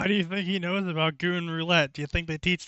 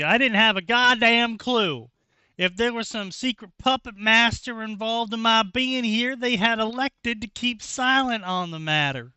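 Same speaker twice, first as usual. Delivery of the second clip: mock narration; 6 semitones higher, 4 dB louder than usual. Reading story text aloud.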